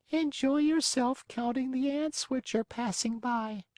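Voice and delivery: put-on a calm old snail calling down. Snail replies.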